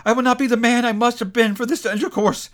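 high, loud, urgent pleading; desperate cry. A narrator's reading. Scrooge clutching the spirit's robe, desperate plea. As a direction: beg to be heard. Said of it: "he cried, tight clutching his robe".

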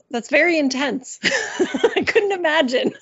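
laughing through the words, rising high; amused disbelief, breaking into giggles; chuckling, then a high laugh. Real, not acted. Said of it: "laughs heard on these lines".

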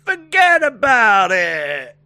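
slow, hammed-up New York "forget about it"; mock New York accent. Co-host hams up a New York accent. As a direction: drag it out like a cartoon New Yorker. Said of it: "You don't say "forget about it" here".

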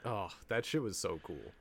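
ordinary talk, nothing marked

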